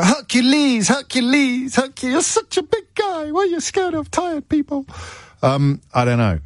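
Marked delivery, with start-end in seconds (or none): 0.0-4.9 very high squeaky mock voice, rising; Eddie Murphy film voice. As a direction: shriek the name like a cartoon. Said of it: "that Eddie Murphy film. I go".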